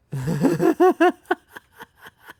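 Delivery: loud, high burst of laughter. Real, not acted. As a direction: let out a shrieking laugh.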